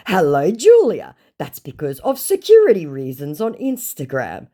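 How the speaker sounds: hammed-up greeting, loud and high at the start; mock a scammer making excuses; put-on officious reply. Reads scammer's reply aloud.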